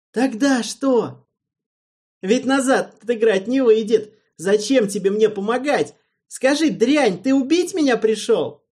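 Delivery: loud high frantic yelling; panicked accusation. A narrator's reading. Alvin frantically accuses Erald of coming to kill him. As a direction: lash out in fear.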